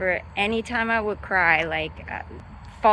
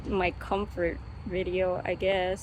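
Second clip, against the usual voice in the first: normal talking voice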